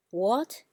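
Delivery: "what" as a rising second tone. Shown as a demonstration. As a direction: lift "what" like a question. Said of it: "keep repeating them".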